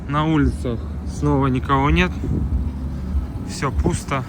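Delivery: loud, upbeat vlog opener; vlog intro. Acted. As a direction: announce the new day to camera. Loud day-two intro to camera.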